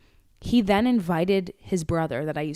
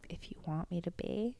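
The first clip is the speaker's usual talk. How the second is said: much softer, coy flirty line; flirty murmur; 16 dB quieter than usual. Retold or imitated. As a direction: purr it like a tease. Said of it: "And I go".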